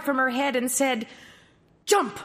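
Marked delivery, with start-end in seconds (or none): 1.9-2.2 a sudden, high, loud shout; loud command. Acted. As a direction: bark the order to jump. Prince commands from the rose bush.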